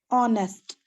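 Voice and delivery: ऑनेस्ट said with an open o and no h; honest with silent h. Shown as a demonstration. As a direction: begin ऑनेस्ट straight on the vowel. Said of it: "जैसे word है ऑनेस्ट".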